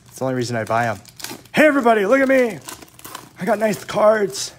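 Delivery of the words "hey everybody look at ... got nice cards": mock show-off. Card collector mocking himself, showing off his cards in a bragging voice. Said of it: "pretend I'm cool".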